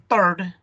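"third" begun with a hard T; non-native "third", no th. Shown as a demonstration. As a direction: mispronounce "third" like "turd". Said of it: "como si fuera una T".

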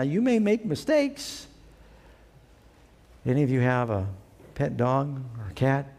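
ordinary talk

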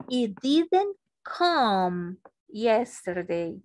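stretched out slowly, word by word; very slow dictation. Shown as a demonstration. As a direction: drag it out so they can copy. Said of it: "para negar sería". (muy despacio, dictando)

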